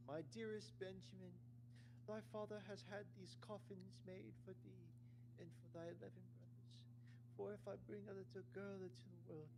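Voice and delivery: high falsetto grieving mother; mother warning her son Benjamin, in a high falsetto. Acted. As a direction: read it as a sad high mother. Reads the mother's lines.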